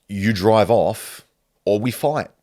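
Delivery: ordinary delivery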